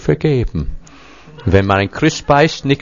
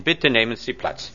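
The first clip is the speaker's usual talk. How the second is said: ordinary delivery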